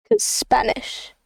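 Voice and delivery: soft unvoiced whisper, slow; whispered aside. Real, not acted. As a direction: breathe it as a joke aside. Whispered aside about Spanish.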